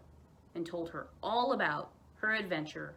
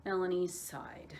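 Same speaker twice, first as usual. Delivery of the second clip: usual speaking voice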